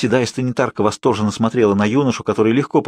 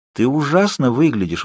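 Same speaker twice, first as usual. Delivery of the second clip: usual speaking voice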